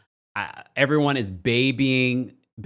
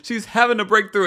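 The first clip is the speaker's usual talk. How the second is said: high, loud, through real laughter; cracking up while imitating her; laughing mockery; 10 semitones higher, 6 dB louder than usual. Real, not acted. Mocking the scene through laughter.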